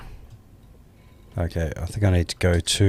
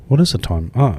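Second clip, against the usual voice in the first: ordinary talk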